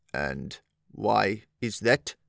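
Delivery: put-on slow, low old soldier; low harsh voice. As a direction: drag it out, wary and low. Old soldier asks slowly.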